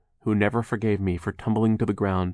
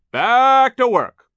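very high, loud and stretched-out order; drawn-out shout; 11 dB louder, much slower than usual. A narrator's reading. Foreman orders workers back, drawn-out shout. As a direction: holler it, dragging the words out. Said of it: "he shouted".

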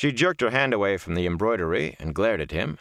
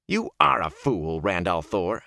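exasperated scolding; slower than usual. A narrator's reading. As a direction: scold him slowly, leaning on the insult. Angry scolding, fist shaken.

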